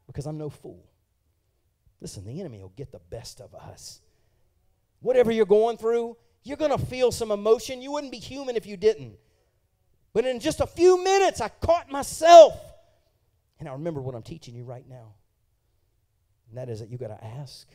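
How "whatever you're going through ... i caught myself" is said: loud, rising sermon climax; preaching at full force. Real, not acted. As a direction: preach it out to the crowd.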